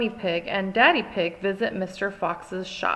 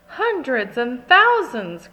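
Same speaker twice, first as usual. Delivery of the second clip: excited cry; 4 semitones higher, 6 dB louder than usual. A narrator's reading. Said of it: "cries Peppa".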